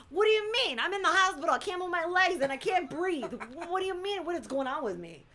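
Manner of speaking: high, loud incredulous protest, easing off; indignant, worked-up retelling of a patient; exasperated patient. Retold or imitated. Acted patient snapping at hospital staff.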